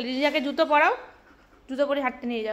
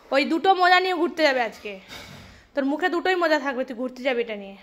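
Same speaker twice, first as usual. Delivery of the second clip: ordinary talk, nothing marked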